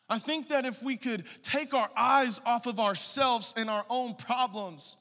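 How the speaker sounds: loud, high preaching at full force; fiery preaching. Acted. Pastor urging the congregation, loud fiery preaching. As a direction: preach it hard to the church.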